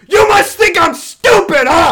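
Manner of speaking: high, full-volume retold taunt; acted indignant, triumphant holler; gloating shout. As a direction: yell it at the beaten opponent. Gloating at killed enemy, retold.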